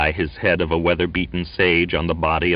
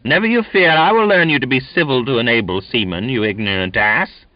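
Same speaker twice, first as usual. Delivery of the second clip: high, loud scornful sailor; angry threat; 6 semitones higher, 5 dB louder than usual. A narrator's reading. Donkin scolding the cheeky boy, angry and loud. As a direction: threaten him with contempt.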